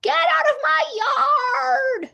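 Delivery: high, loud furious yell; angry shout. A narrator's reading. Eugenia yells at the pig in her yard, furious. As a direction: shriek it at the pig. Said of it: "shouted Eugenia".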